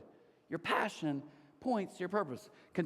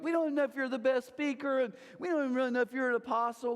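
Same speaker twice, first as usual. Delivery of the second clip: higher-pitched sceptical challenge; imitated quibbling objection, pitched up; mock arguing; 6 semitones higher, faster than usual. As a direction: argue back like doubting critics. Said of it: "They're kinda arguing like".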